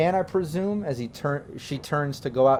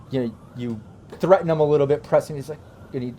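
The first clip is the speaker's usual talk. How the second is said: usual speaking voice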